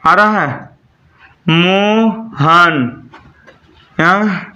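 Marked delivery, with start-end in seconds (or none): 1.5-3.1 मोहन very slow, मो then हन; syllable by syllable. Shown as a demonstration. Teacher showing students syllables, मोहन split as मो, हन. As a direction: stretch मो, pause, then हन. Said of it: "syllable क्या हुआ".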